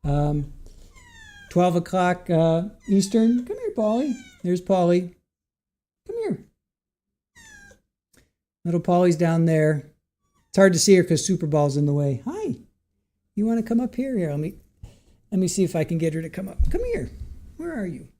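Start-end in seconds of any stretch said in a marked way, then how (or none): 3.5-4.2 high coaxing call; calling the cat. Real, not acted. Owner calling Polly over, high coax. 6.1-6.5 very high, soft coax; calling the cat. Real, not acted. Owner calling Polly, very high coax. 12.2-14.2 very high soft hi; cat greeting. Real, not acted. Owner greeting Polly, squeaky high.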